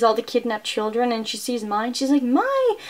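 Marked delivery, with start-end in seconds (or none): 2.2-2.8 sharply higher cry of a name; mother crying out a name, voice jumping up high; calling out. Retold or imitated. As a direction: call to a daughter you just spotted. Mother spots her lost daughter.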